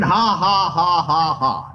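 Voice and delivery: deliberate, spiritless "ha ha" laugh; put-on laugh, evenly spaced and falling away; forced laugh. Shown as a demonstration. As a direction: laugh on purpose without feeling it. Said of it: "that's the way it sounded".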